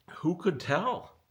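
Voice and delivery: drawn-out "Who could tell?" with a disbelieving lift; incredulous. Real, not acted. Exasperated rhetorical question.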